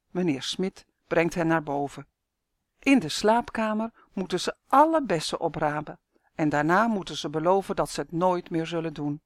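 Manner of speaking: ordinary delivery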